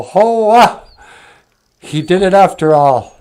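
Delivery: high, loud "hoa" of wonder; awed friends' discovery; amazed exclamation. Acted. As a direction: burst out in astonishment, then marvel.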